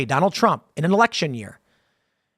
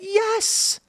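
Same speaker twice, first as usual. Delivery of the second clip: emphatic yes. Real, not acted. Emphatic reply to doubters.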